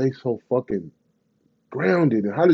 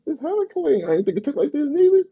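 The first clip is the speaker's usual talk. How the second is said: high squeal. Retold or imitated. Telling the crowd about his first taste, high delighted squeal. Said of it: "I said".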